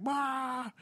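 ghost jump scare. Retold or imitated. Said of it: "It's like".

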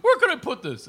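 high, fake-innocent question; innocent act. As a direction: play dumb before the prank. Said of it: "and he goes".

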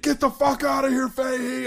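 high, strained mock instructor shout; acted angry yell. Instructor who broke bearing chasing off recruit, high acted shout. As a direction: holler it high, chasing someone off.

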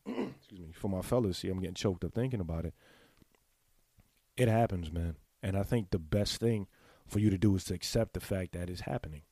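ordinary talk

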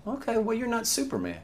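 a student letting the teacher off the hook; a hint of a forgiving kid, airy and high; lightly voiced student.

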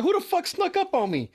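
high startled yelp; startled shout. Retold or imitated. Retelling being startled on the porch, high and alarmed. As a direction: jump at a voice out of nowhere.

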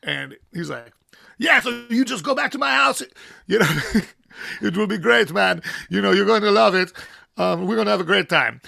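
put-on loud, high, hearty German accent; a big friendly German welcome. Imitating the tattoo shop owner, loud and hearty German accent. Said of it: "these German accents are great".

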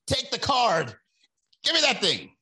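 insistent high voice of the kiosk guy; pushy masseur. Retold or imitated. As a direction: shove the card at them, pushy and high. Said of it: "He's like".

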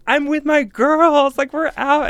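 high, loud, slow sassy brag; hammed-up sassy voice; mock sassy. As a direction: play it up like a confident party girl. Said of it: "I was just like".

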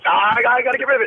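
high, fast panicky gabble; mock panic, rushing the words; phobic panic. Mock panic over losing title.

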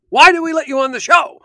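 high, hollered game show host exasperation; Regis. Acted. Co-host plays host giving contestant the business, loud impression. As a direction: berate the contestant at full volume as a TV host. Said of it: "I miss Regis".

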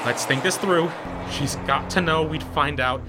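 ordinary delivery

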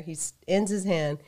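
normal talking voice

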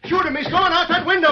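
high, loud urgent cry; panicked warning; alarmed shout. Acted. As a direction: point and yell as he escapes. Intruder escaping through the window.

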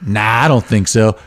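slow, unbothered dismissal; drawn-out, offhand refusal; Brad Pitt. Acted. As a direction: brush off the threat in a lazy movie-hero voice. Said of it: "it's Brad Pitt and Christoph Waltz".

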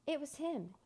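sulky. A narrator's reading. Wolf blaming the boy to Jack, high and sulky. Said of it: "it said sulkily".

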